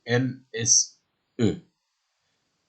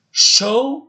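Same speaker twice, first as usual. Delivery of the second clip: 1 high word demo; 13 semitones higher, 5 dB louder than usual. Shown as a demonstration. Teacher calling out the word Show to the class. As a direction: Show said much higher and louder. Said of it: "qui veut dire montrer".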